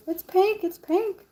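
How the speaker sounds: high sing-song showing off; giddy. Real, not acted. Showing viewers her pink cast, gushing. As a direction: gush over the colour.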